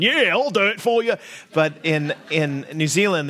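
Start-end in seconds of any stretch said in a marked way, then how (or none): 0.0-1.1 higher, louder eager Australian voice; cheery Australian. Retold or imitated. Contrasting Australian helpfulness with Kiwi reserve, higher, cheery Australian. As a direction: play the obliging Aussie clerk. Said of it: "that's Australian".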